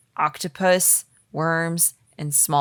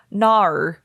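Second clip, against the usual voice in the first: Australian no, said Nar; 3 dB louder than usual. Shown as a demonstration. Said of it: "the best Australian thing I can do is saying no".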